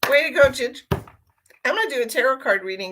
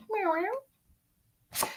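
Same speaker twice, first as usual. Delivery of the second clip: much higher, soft imitated meow; high mock meows; 12 semitones higher, 6 dB quieter than usual. Owner meowing for her cat, high and soft. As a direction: meow like the kitty.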